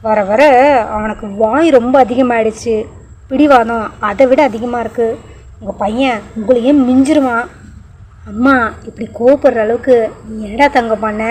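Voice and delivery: plain reading voice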